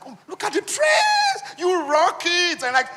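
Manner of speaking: high, loud, slow squealing praise; gushing girlfriend. Retold or imitated. Girlfriends praising a friend's dress, high slow squeal. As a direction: gush over the dress like a hyped girlfriend.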